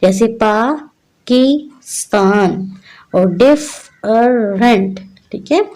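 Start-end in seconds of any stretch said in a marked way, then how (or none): 0.3-2.7 पाकिस्तान in three slow parts, "paa - ki - staan"; slow teaching split of पाकिस्तान; split into syllables. Shown as a demonstration. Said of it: "तीन parts में divide करके". 3.4-5.0 डिफरेंट in three slow parts, "dif - er - ent"; slow teaching split of डिफरेंट; split into syllables. Shown as a demonstration. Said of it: "तीन parts में divide करके".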